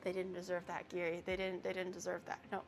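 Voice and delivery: low, soft murmur to oneself; quiet, low muttered pep talk; hushed self-calming. Real, not acted. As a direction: talk yourself down quietly, under your breath. Talks self down after being baited.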